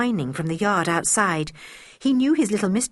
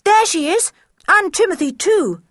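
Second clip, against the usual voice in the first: high, loud excited call; boy shouting with excitement from a distance; cried out; 12 semitones higher, 6 dB louder than usual. A narrator's reading. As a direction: call out across the cliffs to a friend. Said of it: "cried Julian".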